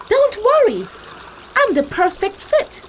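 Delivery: squeaky straw asking for rescue, urgent; Emmy the straw pleads with Kim to save her family; Emmy the straw pleading. Acted. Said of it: "said Emmy".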